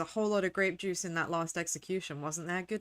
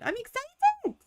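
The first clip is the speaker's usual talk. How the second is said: high, louder squeal of excitement; squeaky excited; 4 dB louder than usual. Pretend. Streamer to chat, high squeaky excited squeal. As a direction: squeal it like a giddy kid.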